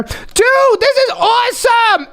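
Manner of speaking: high, thrilled exclamation; excited. Acted. Viewer and host turn invisible, excited.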